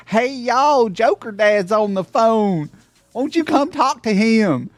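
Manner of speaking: high, loud Southern drawl, slow on the middle line; Southern family accent. Retold or imitated. Streamer imitating Tennessee family calling him to the phone, Southern accent. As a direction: call out like a Tennessee relative in a broad Southern accent. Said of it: "they're like".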